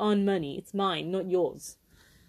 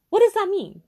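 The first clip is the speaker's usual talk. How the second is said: sharp, high outraged question; 11 semitones higher, 5 dB louder than usual. Real, not acted. Venting at the driving instructor, outraged. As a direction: demand an answer, indignant.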